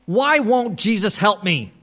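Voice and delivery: imitated despairing question; anguished pleading. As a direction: plead it like a man at a loss. Retelling the man's anguished complaint.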